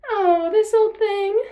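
high, loud, drawn-out mock innocence; theatrical villainess, drawling high; taunting villainess. A narrator's reading. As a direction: taunt your rival, slow and loud. Nemesis toys with stolen prize.

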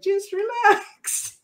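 very high, drawn-out, giggly quote; playful mimicry. Host teasingly echoing guest's advice that recalled her boss, playful mimicry. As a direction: stretch it out high, on the edge of laughter.

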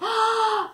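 sharp high blowing gasp; a big huffing breath. Shown as a demonstration. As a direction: blow out hard like the pig. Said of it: "here's your part".

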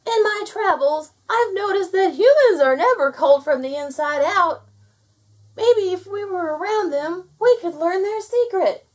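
high, loud otter voice; yelled. A narrator's reading. Otter telling gnome about humans, yelling. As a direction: proclaim the idea in a high yell. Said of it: "yelled the otter".

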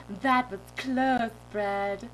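slow, drawn-out mock relief; silly dream-self voice. Driver turning to the bag of bread, goofy relieved voice. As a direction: confide in the bread, goofily stretched. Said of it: "I'm talking like this".

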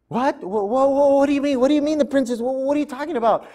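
high, stammering disbelief; can't believe her ears; stunned mother. Acted. Mother hears the princess wants her, high stammering surprise.

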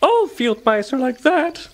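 very high little mouse voice; thoughtful mouse. A narrator's reading. Field mouse explains her fur, thoughtful. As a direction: ponder it in a squeaky mouse voice. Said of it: "deep in thought".

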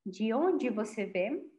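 "De onde você vem?" con la d de "onde" como ch suave, d͡ʒi ond͡ʒi, despacio y bajo; versión en portugués, más baja y suave; portugués modelo. Shown as a demonstration. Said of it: "Então". (modelo en portugués, lento y bajo)

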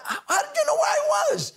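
very high, incredulous squeal; astonished. Retold or imitated. Acts astonishment at being located.

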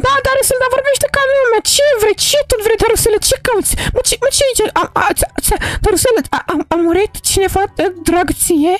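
put-on high, worried little girl asking why he cries; a young girl pleading with a crying friend; girl character voice. Mara asks crying Darusel what's wrong, high worried voice.

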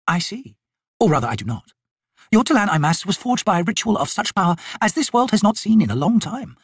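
high-pitched, emphatic noblewoman; haughty lady, lecturing. Acted. Lady explaining the warrior's forged power to Tok, lecturing. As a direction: explain with lofty authority.

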